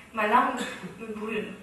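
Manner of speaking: ordinary talk, nothing marked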